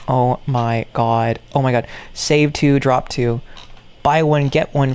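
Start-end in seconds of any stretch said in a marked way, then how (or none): none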